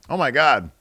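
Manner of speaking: ordinary talk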